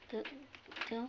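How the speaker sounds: ordinary talk, nothing marked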